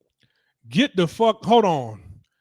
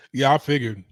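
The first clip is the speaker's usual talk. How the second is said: ordinary talk, nothing marked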